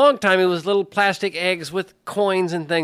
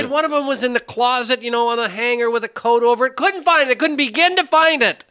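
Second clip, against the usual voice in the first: high, incredulous complaint; exasperated rant; 6 semitones higher than usual. Real, not acted. Father describing the basket hidden in the closet, exasperated rant. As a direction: push the pitch up in disbelief. Still ranting about failed hunt.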